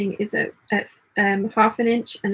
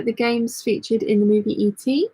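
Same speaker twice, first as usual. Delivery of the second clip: normal talking voice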